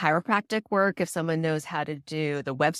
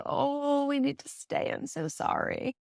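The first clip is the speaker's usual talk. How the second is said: soft apology; 8 semitones higher, 5 dB quieter than usual. Retold or imitated. Doula apologizes to leaving client.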